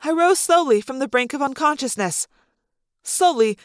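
usual speaking voice

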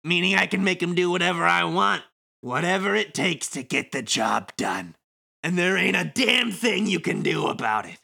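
breezy, mocking wiseguy; sly villain Kubo. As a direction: thank them with smug false gratitude. Plays Kubo reading game script.

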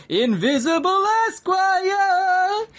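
high, slow drawn-out shout; background shout. Retold or imitated. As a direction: call it out from the back of the mix. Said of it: "in the background going".

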